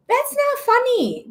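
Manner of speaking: high, slow and outraged; slow indignant protest. Real, not acted. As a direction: set him straight, shocked. Reacting to boyfriend's video.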